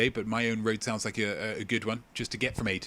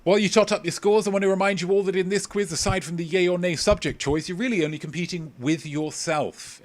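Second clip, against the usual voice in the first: upbeat host address; 7 semitones higher, 3 dB louder than usual. Acted. Quizmaster addresses players, louder.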